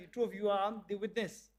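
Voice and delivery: usual speaking voice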